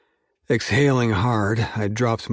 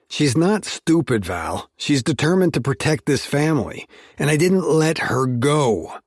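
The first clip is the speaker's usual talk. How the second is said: raised, hard growl through gritted teeth; growled through clenched teeth; 6 semitones higher, 4 dB louder than usual. Acted. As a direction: push each word out through clenched teeth. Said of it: "I growled between clenched teeth".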